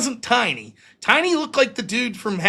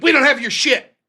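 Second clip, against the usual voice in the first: a mother snapping back, louder; curt denial; 5 semitones higher, 6 dB louder than usual. Retold or imitated. Mother at the door denying she has the games, loud and curt. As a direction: brush him off hard.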